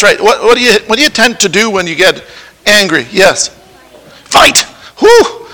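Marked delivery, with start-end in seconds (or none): 5.0-5.5 surprised whew. Real, not acted. Reacting to a child saying fight.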